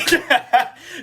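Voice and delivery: sudden high, loud laugh; imitating the friend's shocked laugh; high burst of laughter.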